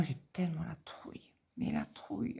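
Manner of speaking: soft breathy whisper; whispered fear. Real, not acted. Confiding her fear to viewers in a whisper. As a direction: hush it, dread creeping in.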